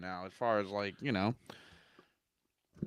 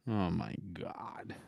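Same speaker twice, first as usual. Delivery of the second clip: slow, whispered dismay; disbelieving whisper; 4 semitones lower, 12 dB quieter, slower than usual. Real, not acted. Podcaster reacting to co-host's bold division prediction, whispered disbelief. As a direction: whisper it slowly under your breath.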